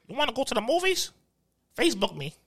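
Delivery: high, lightly voiced coworker asking a girl out; a hint of an awkward guy asking a chick out; mocking coworker. Mocking weird coworker asking a chick out, lightly voiced. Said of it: "he will ask a chick".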